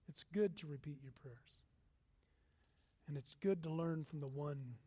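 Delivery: breathy unvoiced whisper; soft intimate whisper of encouragement. Real, not acted. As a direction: whisper it like a secret to the room. Sermon aside, whispered.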